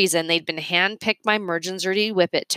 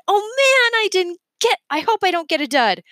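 high, louder, breathless hope; a kid fretting over a prize; eager child; 13 semitones higher, 4 dB louder than usual. Acted. Remy opening his soda, high and eager.

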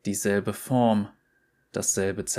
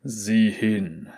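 unheimliche Feuerstimme. Acted. Die Feuerstimme befiehlt.

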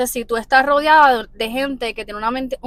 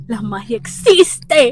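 dramatic slow reveal; 4 dB louder, much slower than usual. Acted.

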